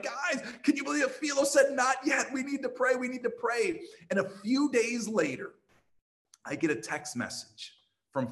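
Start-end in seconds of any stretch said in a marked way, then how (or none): 0.0-3.8 high, thrilled rallying call; rally your friends in amazement; excited. Retold or imitated. Pastor texting all the Christians, high and thrilled.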